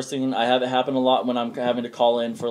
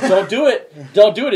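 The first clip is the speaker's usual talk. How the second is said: higher and louder, laughing through it; mock desperate plea; pleading warning; 8 semitones higher, 8 dB louder than usual. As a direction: warn him not to hang up. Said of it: "He's like".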